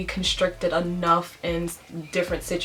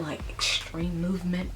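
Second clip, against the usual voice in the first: ordinary talk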